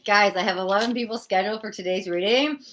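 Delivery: usual speaking voice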